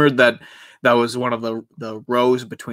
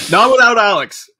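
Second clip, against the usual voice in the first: higher and louder, punching without; loud insistence; 9 semitones higher, 7 dB louder than usual. Real, not acted. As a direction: call it out like a chant. Chanting the running joke.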